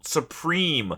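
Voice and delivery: thrilled the favourite finally showed up; real excitement as the wheel lands on him; excited. Real, not acted.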